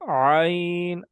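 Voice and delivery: عین with lips spread wide as in a smile, pressure in the throat; tajweed teacher demonstrating the letter ain; throaty ain demo. Shown as a demonstration. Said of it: "عین پڑھتے ہوئے ہونٹوں کو لمبا کریں".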